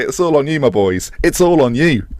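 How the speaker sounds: ordinary delivery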